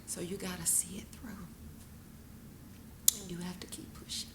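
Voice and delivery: soft unvoiced whisper of encouragement; hushed urging. Mother whispering to her daughter to keep going. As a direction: whisper it gently to the grieving child.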